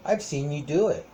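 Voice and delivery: ordinary talk, nothing marked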